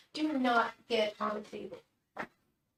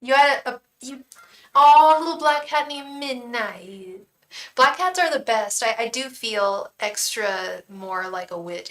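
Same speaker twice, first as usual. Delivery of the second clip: normal talking voice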